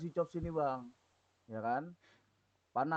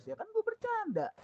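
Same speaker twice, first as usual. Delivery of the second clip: very high mocking whine; mocking the excuse. Storyteller mocking the thug's excuse, very high. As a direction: mimic his lame excuse. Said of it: "ngeles-ngelesnya gitu".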